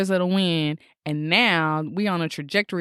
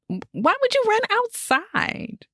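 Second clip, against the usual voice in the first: incredulous. Real, not acted. Incredulous rhetorical question.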